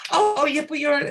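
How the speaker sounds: high, loud, flustered catching of words; flustered backpedal. Retold or imitated. Caught out by the American colleague, flustered high backpedal. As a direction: scramble to take it back. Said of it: "catching my words".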